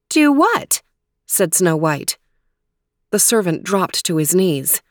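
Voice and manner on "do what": puzzled princess. Acted.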